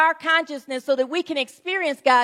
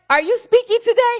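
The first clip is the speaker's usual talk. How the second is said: surprised question; 10 semitones higher, 6 dB louder than usual. Retold or imitated. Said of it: "He was like".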